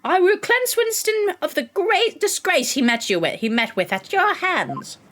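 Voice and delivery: high-pitched theatrical vow; hammed-up villain declaration; vengeful rival prosecutor. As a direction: declaim it grandly, slowing at the end. Voices rival prosecutor vowing revenge.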